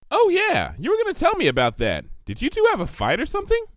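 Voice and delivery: high, bouncy and cheerful; bubbly pony voice. A narrator's reading. Pinkie gushes to Twilight about her cookies, high and bubbly. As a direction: gush like a sugar-loving party pony.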